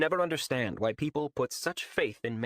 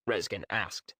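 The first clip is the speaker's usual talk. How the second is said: ordinary delivery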